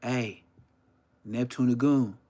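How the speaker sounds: usual speaking voice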